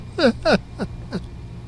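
soft airy laugh at himself; a quiet laugh breaking the act; breathy laugh. Real, not acted. Laughs at own plea.

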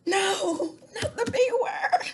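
high, laughing mock horror; hammed-up shock, giggling; mock gasp. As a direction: pretend to be scandalised while laughing.

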